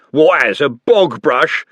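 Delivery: slow, loud, mocking tough; a menacing thug's sneer; sneering bully. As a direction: drag out the jibe. Andy mocking Nutt.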